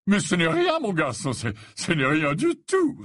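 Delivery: high, effusive brushing-off; genial old professor. Acted. Slughorn brushing off Harry's thanks, genial. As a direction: wave away thanks in professor voice.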